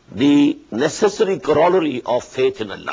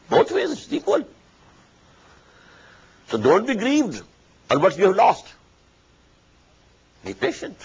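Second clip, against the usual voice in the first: much higher, impassioned exhortation; fervent preaching; 6 semitones higher than usual. Acted. Preacher urging the faithful to be patient, high and impassioned. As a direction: raise the pitch and plead.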